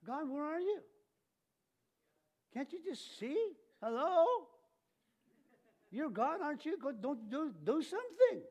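high, exasperated plea to God; frantic complaint to God; mock desperate prayer. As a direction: whine at heaven impatiently. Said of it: "we say things like this".

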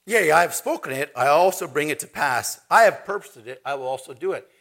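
loud, forceful declamation; preaching scripture. Acted. Preacher declaiming God's words to the congregation, forceful and loud. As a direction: proclaim the verse with full weight.

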